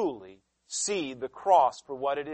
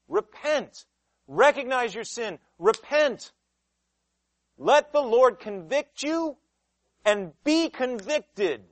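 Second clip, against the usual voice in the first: higher, emphatic call to repent; urgent command; 6 semitones higher, 4 dB louder, slower than usual. Acted. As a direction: command them to turn. Preacher commanding.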